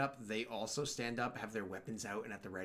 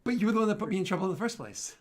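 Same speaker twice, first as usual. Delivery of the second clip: heated argument; 7 semitones higher, 12 dB louder, much faster than usual. Acted. GM voices Solarian in argument.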